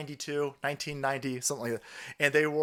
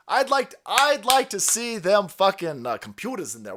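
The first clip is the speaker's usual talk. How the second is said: ordinary talk, nothing marked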